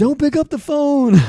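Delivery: high, loud yell. Retold or imitated. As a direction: shout it across the house. Yelling at family during dial-up.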